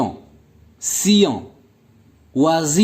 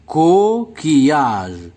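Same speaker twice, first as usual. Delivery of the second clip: "Coquillage" slow, with a long first syllable, co, qui-age; "Coquillage" measured out slowly for counting; slower than usual. Shown as a demonstration. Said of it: "Donc, trois syllabes".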